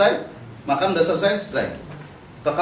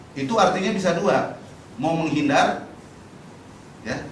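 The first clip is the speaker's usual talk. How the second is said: normal talking voice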